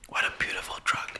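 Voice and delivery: breathless whisper of bliss; whispered awe. Retold or imitated. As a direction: hush it like a blissful secret. Dreamy recall of morphine high.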